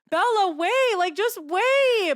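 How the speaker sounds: high, loud mock whine, drawn out on the last word; retold tearful plea. Running to the bathroom after Bella, retold tearful plea. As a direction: imitate pleading through tears. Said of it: "I start crying".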